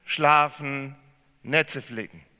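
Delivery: laut, eindringlich gepredigt, hoch gespannt; predigend. Acted. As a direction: mit voller Kraft zur Gemeinde predigen. Preaching sermon opening. (volle Predigt, hoch und laut)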